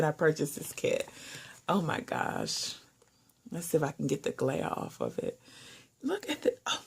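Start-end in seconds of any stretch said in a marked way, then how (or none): 6.0-6.8 very high, teary squeal of delight; overwhelmed to the point of happy tears; tearful excitement. Real, not acted. Vlogger seeing the purple and pink pins, teary high-pitched gush. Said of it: "I'm just so excited".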